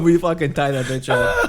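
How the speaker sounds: ordinary delivery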